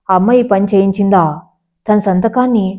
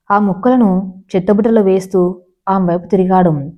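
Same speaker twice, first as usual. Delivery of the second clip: ordinary delivery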